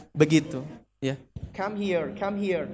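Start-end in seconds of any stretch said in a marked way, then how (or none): 1.5-2.7 very soft repeated call; Westerner beckoning. Shown as a demonstration. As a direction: quietly mimic a Westerner calling someone over. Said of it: "itu orang-orang barat katanya". (menirukan orang Barat memanggil dengan pelan)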